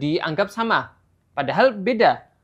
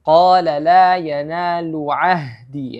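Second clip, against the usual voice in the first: slow, louder recitation; Quranic recitation; 5 dB louder, slower than usual. Shown as a demonstration. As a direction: chant it with held vowels. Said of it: "Kemudian kita lanjut".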